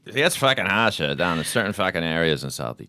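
put-on loud, high inner-city Boston accent; hammed-up, harsher Boston street talk. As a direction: lay the Boston accent on thick. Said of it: "the more harsh inner city accent".

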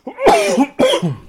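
hard cough after swallowing wrong; choking cough. Real, not acted. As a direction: cough out a drink gone down wrong. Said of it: "Choking on whiskey".

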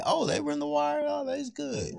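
high squeaky mocking voice of people. Mocking what people say about the show in a high squeak. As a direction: squeal it like a gushing fan.

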